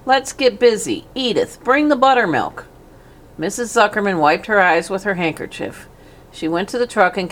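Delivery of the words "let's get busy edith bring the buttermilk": high, bustling farmer's voice; brisk and hearty. A narrator's reading. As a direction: brush off the tears, get busy. Zuckerman rallying the family.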